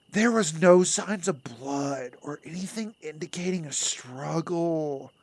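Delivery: lightly put-on announcer voice; loud, over-the-top character voice. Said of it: "Gotta put on the voice".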